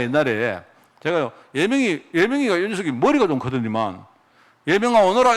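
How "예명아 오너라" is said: 높고 크게 "예명아, 오너라." 부르기; 목청 높여 아이를 부르듯; calling out. Retold or imitated. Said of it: "이러면요". (아이를 부르는 목사, 크게 부르는 소리)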